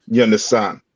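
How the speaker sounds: ordinary talk, nothing marked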